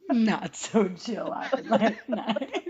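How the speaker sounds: very slow, laughing mimicry; laughing echo of a quote. Listener echoing the friend's movie quote, laughing and drawn out. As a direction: stretch each word out through laughter.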